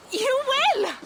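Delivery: high, loud burst of joy; overjoyed. Acted. Toka thrilled that the clan will help. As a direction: shout it out in delight.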